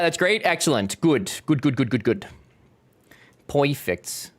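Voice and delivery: ordinary delivery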